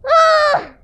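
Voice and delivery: high loud shout; Deku protest shout. A narrator's reading. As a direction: yelp in protest. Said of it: "protested Link".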